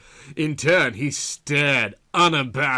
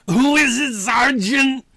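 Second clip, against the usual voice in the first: loud, high gruff demand; growled question; 12 semitones higher, 7 dB louder than usual. A narrator's reading. Tired detective growling at the sergeant. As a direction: growl it at the interruption. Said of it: "Andrew growled".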